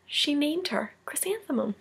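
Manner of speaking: soft whispered reveal. A narrator's reading. Teacher reveals the baby's name to the class, whispered. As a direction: hush it like a sweet secret. Story's sweet final reveal.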